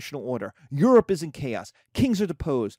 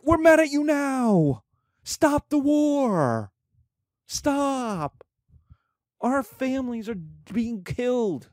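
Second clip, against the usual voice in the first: mock-shout the people's demands at the tsar; angry crowd yelling; 9 semitones higher, slower than usual. Angry Russian people voiced.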